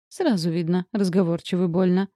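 ordinary delivery, nothing marked

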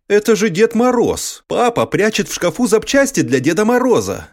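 put-on wide-eyed little boy discovery; child voice. A narrator's reading. (детский голос, выше)

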